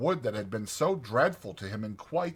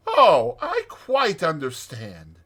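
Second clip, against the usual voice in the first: soothing; 5 semitones higher, 7 dB louder, slower than usual. A narrator's reading. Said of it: "said the rat soothingly".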